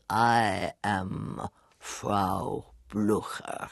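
very slow, careful, each word weighed; solemn slow intro line; slow measured delivery. Shown as a demonstration. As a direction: draw it out with deliberate care. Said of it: "it's said with such measurement".

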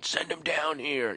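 high, slow mock holler, held back in volume; barroom mob yell. Angry drinkers calling for the comic, mock holler. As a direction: imitate a distant yell from the bar. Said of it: "people were down at the bar going".

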